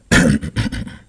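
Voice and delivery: loud, low throat clearing; gruff preparatory cough. A narrator's reading. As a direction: clear your throat noisily before speaking. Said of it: "coughed to clear his throat".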